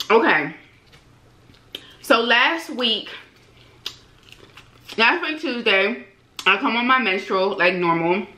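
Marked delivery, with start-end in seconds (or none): 5.0-8.3 chewing while talking; muffled words around food; mouth full of chicken. Real, not acted. Seen 4: Bites chicken at -1.0; jaw and lips show chewing until 3.7.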